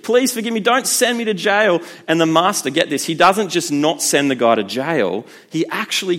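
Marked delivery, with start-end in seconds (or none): 0.0-1.8 imitating a desperate debtor's plea; begging servant. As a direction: beg the master not to jail you. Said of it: "he's like".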